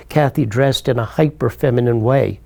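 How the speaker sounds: normal talking voice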